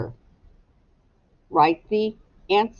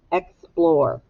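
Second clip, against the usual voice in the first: ordinary delivery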